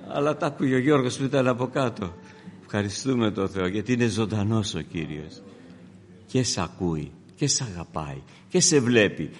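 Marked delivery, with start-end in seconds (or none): 2.6-9.3 much lower and softer, tender; hushed reverence. Acted. Preacher softly assures the congregation, hushed. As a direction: drop to a near-hush.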